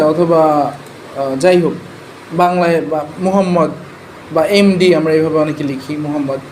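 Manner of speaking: ordinary talk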